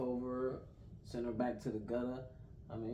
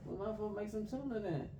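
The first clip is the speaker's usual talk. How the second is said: higher-pitched retelling of a squabble; retold squabble; 7 semitones higher than usual. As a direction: act out the bickering in a raised pitch. Retold squabble over hash and tuna.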